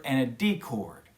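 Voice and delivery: ordinary talk, nothing marked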